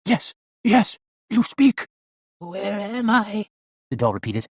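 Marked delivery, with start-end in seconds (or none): 0.0-1.9 high, loud cry of excitement. A narrator's reading. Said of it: "Darkwell cried out". 2.4-3.5 high-pitched, very slow raspy puppet; raspy doll voice. A narrator's reading. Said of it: "in the same raspy voice".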